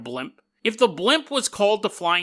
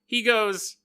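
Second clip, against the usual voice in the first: ordinary delivery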